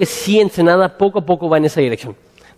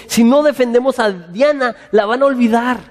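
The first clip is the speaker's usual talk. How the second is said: higher-pitched mock plea for Diana; mock worried idolaters; 7 semitones higher than usual. Preacher mimicking idol makers defending Diana, high mock worry. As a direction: voice the worshippers in a raised, mocking pitch. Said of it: "ellos dicen".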